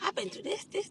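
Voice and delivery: high, soft, half-whispered exasperation; frustrated complaint. Retold or imitated. Her old self complaining to God, high and breathy. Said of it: "I used to be like".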